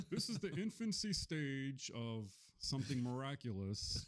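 drawn-out grand announcement voice; funny put-on voice. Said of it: "What if I talk like that all day?".

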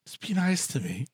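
mock-tearful plea to a cat; pleading near tears. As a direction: beg the cat softly, as if about to cry. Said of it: "almost in tears".

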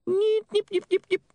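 very high repeated nips; tiny high-pitched imp chirps; fast-forward squeaks. Acted. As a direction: squeak like a skipping tape. Imp imitates fast forward noise.

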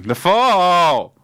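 high, loud, drawn-out cry; hammed-up lament; mock wail. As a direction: wail the last word long and high. Mock lament at absent guest.

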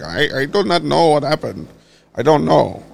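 playing a clueless Russian official with a thick Russian accent; mock Russian accent. Vlogger mocking Russian officials in a put-on Russian accent. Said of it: "who knows what kind of fucking accent that was".

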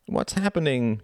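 high, plaintive woman's voice; querulous high-pitched bleat. A narrator's reading. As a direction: bleat the question feebly. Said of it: "she bleated".

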